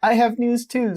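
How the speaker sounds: high, loud character read; Mabel voice. Acted. Mabel announcing her news, high character voice. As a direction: pitch it up, announcing. Reading Mabel's dialogue aloud.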